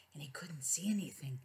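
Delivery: hushed spooky whisper; breathy storytelling whisper. Acted. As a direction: whisper it as if in the dark.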